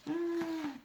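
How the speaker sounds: plain reading voice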